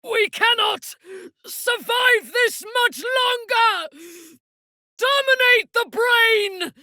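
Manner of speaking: high, loud urgent yell; battle shout. Acted. Fighter calling to allies in a loud urgent shout. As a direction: yell orders over the fight.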